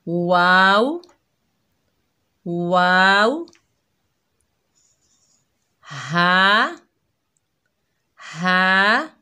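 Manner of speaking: वाव and हा said loud and deliberate; teacher reciting letters aloud; clear letter recitation. Shown as a demonstration. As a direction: call out each letter clearly. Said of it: "पढ़िए।".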